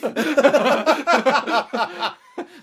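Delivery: loud burst of laughter. Real, not acted. Big laugh at own joke.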